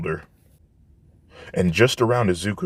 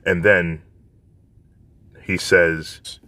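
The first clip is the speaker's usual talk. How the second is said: ordinary delivery, nothing marked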